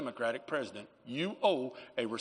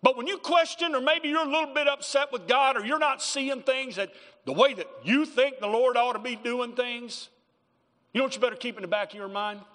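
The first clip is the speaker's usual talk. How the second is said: loud, high, driving pulpit preaching; fiery sermon delivery; preaching at full force; 9 semitones higher, 6 dB louder than usual. Acted. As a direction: preach it hard to the congregation.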